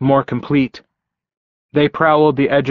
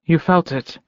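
higher, lightly voiced woman; woman's voice; 5 semitones higher than usual. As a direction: lift the voice for Elizabeth. Elizabeth voiced by narrator.

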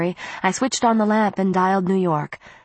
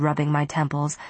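ordinary delivery, nothing marked

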